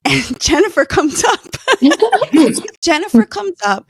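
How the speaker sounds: laughing through the words; real laughter breaking into the line. Real, not acted. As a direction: giggle out the name, delighted. Laughing while recalling finding Jennifer.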